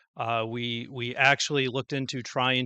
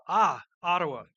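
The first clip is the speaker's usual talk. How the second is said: put-on "Ah, Ottawa" in a light French accent, lifted and a touch louder; 3 semitones higher, 5 dB louder than usual. Ambassador greeting the mayor, mild French accent. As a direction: imitate the French ambassador saying "Ottawa" with delight. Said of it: "or at least an accent barrier".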